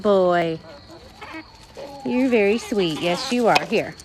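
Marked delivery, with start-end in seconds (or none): none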